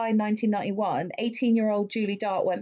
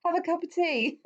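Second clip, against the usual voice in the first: pitched up, chirpy mock Brit offering tea; mock fussy Englishman; 7 semitones higher than usual. Kidnapper fussing over her, as a mock chirpy Brit. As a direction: play the cheery tea-pushing Englishman, higher. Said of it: "He's like".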